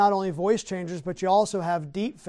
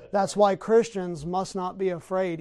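ordinary talk

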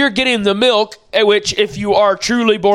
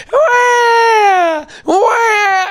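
put-on crying baby; 11 semitones higher than usual. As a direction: wail it out like a baby in tears, much higher. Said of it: "And they're crying".

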